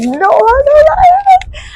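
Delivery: real giddy swoon. Real, not acted. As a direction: stretch it out in a swoon. Swoons repeating the line.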